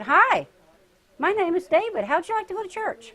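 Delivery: put-on high, bright little boy asking sweetly. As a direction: play the eager five-year-old inviting. Boy inviting her to church.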